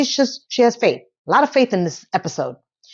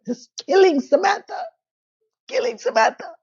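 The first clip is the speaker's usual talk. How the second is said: high-pitched wail, hammed up; play-sobbing at a much higher pitch; mock crying; 10 semitones higher than usual. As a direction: whine it as if on the verge of tears. Mock crying over Samantha's scene.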